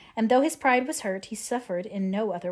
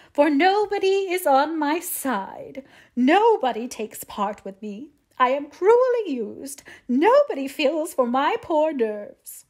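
high, drawn-out self-pitying lament; melancholy; 6 semitones higher, 7 dB louder, slower than usual. A narrator's reading. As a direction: wail slowly as if sorely ill-used. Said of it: "she added in a melancholy tone".

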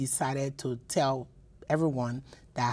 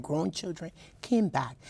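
ordinary delivery

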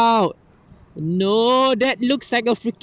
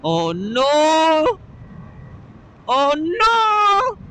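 alarmed cry; 8 semitones higher, much slower than usual. Real, not acted. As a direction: cry out in sudden dismay. Alarmed by the garbage truck.

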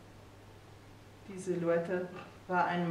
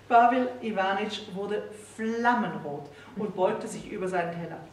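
ordinary delivery

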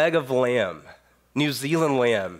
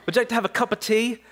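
high, quick prissy British "Would you like to have a cup of tea?"; mock British accent; 9 semitones higher, much faster than usual. Young American imagining his hosts' British teatime offer, mock British accent. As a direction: imitate a proper British host offering tea. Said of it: "that's so British".

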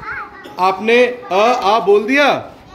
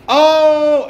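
"आओ" stretched long and higher; drawn-out demo; 6 semitones higher, 4 dB louder than usual. Shown as a demonstration. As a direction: drag out "आओ" to show the mistake. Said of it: "ऐसे नहीं बोलना".

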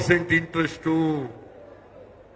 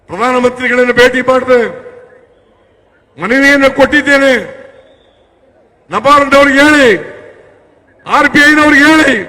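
rally shout; 5 semitones higher, 9 dB louder than usual. Real, not acted. Rally speech, appeal to Centre.

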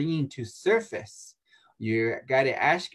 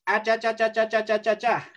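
high mock "cha cha" chatter; negative chatter; 9 semitones higher than usual. Shown as a demonstration. Healer mocks negative talk, high cha chatter. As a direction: mimic empty nagging noise.

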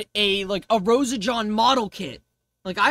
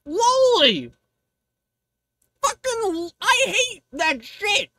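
outraged yell; 7 semitones higher than usual. Real, not acted. Outraged punchline.

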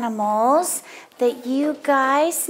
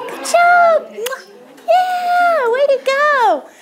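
high squeal of praise; excited; 11 semitones higher, 3 dB louder than usual. Real, not acted. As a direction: cheer them on brightly. Praising children.

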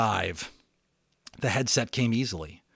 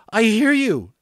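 much higher, much louder, hitting hear; eager repeat; 12 semitones higher, 10 dB louder than usual. Acted. Boy repeating to the radio voice, hear stressed. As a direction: say it again, pressing on hear.

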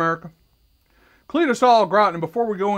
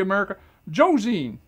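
ordinary delivery

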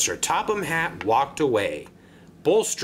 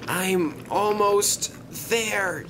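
high, slow, straining gasp; gasping with effort; 7 semitones higher, slower than usual. A narrator's reading. Engine struggling up the hill near the top, gasping. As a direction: force it out slowly, straining upward. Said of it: "he gasped".

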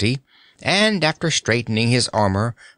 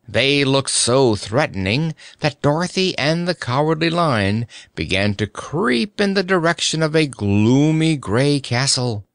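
usual speaking voice

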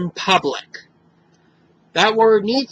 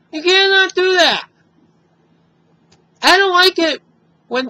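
upset, angry; 5 semitones higher than usual. Real, not acted. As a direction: let real upset push the voice up. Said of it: "I'm upset and I sound angry".

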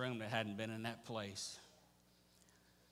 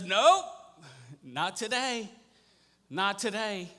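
loud, high, booming veto; forceful, raised-voice interruption; emphatic refusal; 7 semitones higher, 11 dB louder, slower than usual. Acted. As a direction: shut the whole plan down at full voice. God interrupts the fired-up army.

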